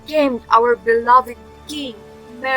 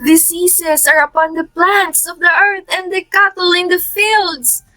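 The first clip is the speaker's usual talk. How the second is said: raised-pitch, declaimed plea; urgent lament; 6 semitones higher than usual. Acted. Townsperson reporting the plague to the king, urgent high lament.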